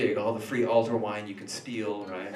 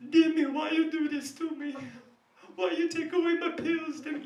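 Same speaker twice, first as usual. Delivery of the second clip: much higher, whiny mother voice; pleading old mother. Acted. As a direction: plead like his mother in the vision. Demon-vision mother pleading.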